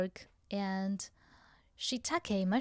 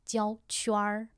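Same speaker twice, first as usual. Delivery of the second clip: normal talking voice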